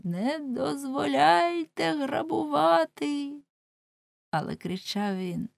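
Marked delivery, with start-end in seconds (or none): 0.0-3.3 slow, much higher drawn-out plea; bloodied cry. Acted. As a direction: keep crying out though beaten. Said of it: "не переставав кричати". (закривавлений Уленшпігель розтягнуто кричить)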